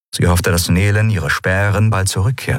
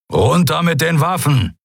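raised-pitch growly order; commanding; 8 semitones higher than usual. A narrator's reading. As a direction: order them to disarm. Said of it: "befahl Keru". (befehlend, mit angehobener Stimme)

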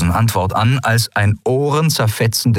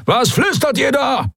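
high shouted challenge; guard's yell. Acted. Halbhorn to the captives, a high angry shout. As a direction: snap at the whispering prisoners. Guard yells at whispering prisoners.

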